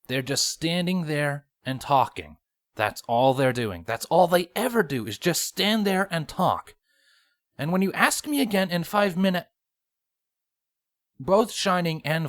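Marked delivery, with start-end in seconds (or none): 0.1-9.5 rising higher, louder annoyance; irritated rant. Acted. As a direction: build into an exasperated rant.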